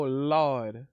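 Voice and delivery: ordinary delivery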